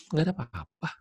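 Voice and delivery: imitating a wife who won't say; high, soft denial that anything is wrong; evasive woman. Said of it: "Ngomongnya gitu deh perempuan".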